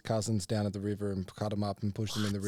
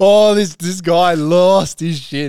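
laughing hard; 7 semitones higher, 15 dB louder, much slower than usual. Real, not acted. Laughing while retelling.

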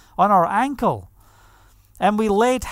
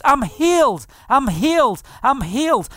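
mild scream, higher at first then easing; retold joyful scream; 4 semitones higher than usual. Woman just healed after prayer, joyful retold scream. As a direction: cry it out in mock-screamed amazement. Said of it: "And she screams".

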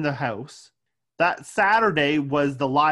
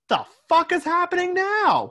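high, loud disbelief; incredulous; 12 semitones higher, 4 dB louder than usual. Retold or imitated. Reacting to the movie's twist, a high incredulous yell. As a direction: shriek it in total bafflement. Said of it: "I was like".

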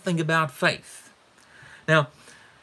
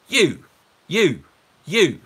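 each "you" a loud, separate punch; stress on YOU; 2 semitones higher, 7 dB louder, much slower than usual. Shown as a demonstration. Preacher answering what Jesus is highlighting, hammering "you". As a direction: hammer "you" three times with a beat between. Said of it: "what's Jesus highlighting here?".

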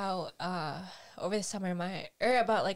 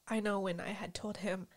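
ordinary delivery

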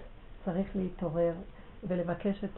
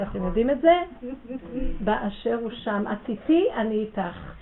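ordinary talk

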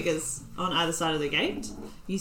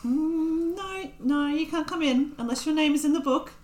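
high-pitched gnome gatekeeper; officious gnome; 7 semitones higher than usual. Acted. Gnome gatekeeper refuses entry to strangers, officious.

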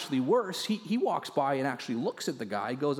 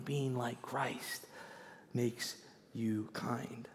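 slow and softer, trailing into a whisper on "makes you kind"; hushed repeat; 9 dB quieter, much slower than usual. Shown as a demonstration. Preacher quietly repeats his key line for the church. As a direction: let the line fall away to a whisper. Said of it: "repeats key line softly".

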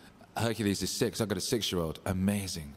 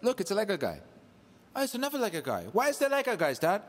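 put-on high little kid voice; child voice; 12 semitones higher, 4 dB louder than usual. Dad voicing his son on the long train ride, in a high kid voice. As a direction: play the six-year-old, patient. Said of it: "He's like".

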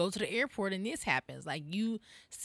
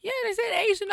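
imitating mom; 13 semitones higher, 6 dB louder, faster than usual. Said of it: "She was like".

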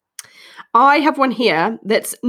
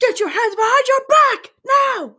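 mock police shout. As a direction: yell it like an arresting officer. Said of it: "you could be like".

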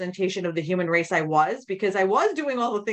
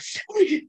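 teary laugh; 12 semitones higher than usual. Real, not acted. Laughing while crying over onions.

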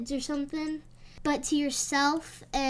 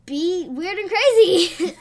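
laughing; 5 semitones higher, 9 dB louder than usual. Real, not acted. Laughing through her advice.

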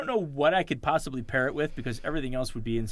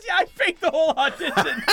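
laughing admission; 11 semitones higher, 4 dB louder than usual. Retold or imitated. Girl admits the trick.

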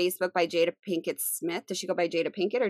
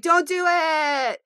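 high, drawn-out mock yell; yell across room; 9 semitones higher, 5 dB louder, much slower than usual. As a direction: call out a long, pleading warning across a party. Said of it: "I'll yell across the room".

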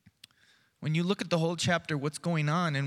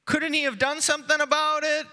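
higher, louder grumbling question; complaining crowd; 9 semitones higher, 5 dB louder than usual. Retold or imitated. Pastor voicing the crowd blaming Jesus, louder grumble. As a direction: voice the crowd's accusing gripe.

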